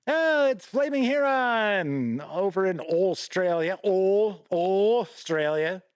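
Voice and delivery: loud, put-on Aussie voice, Australia dragged out and rising; hammed-up Aussie greeting to a viewer; a half-assed Aussie accent, playfully overdone; mock Aussie accent. Shown as a demonstration. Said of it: "I say it like that all the time".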